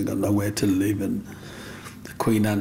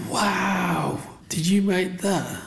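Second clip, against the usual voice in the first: higher, slow wondering voice; amazed girl; 8 semitones higher, much slower than usual. Retold or imitated. Neighbour girl seeing the ant houses, amazed and high. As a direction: imitate a wide-eyed neighbour girl. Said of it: "She went".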